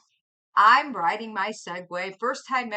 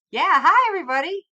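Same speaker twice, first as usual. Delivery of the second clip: 1 excited greeting; 9 semitones higher, 10 dB louder, faster than usual. Real, not acted. Greeting chat.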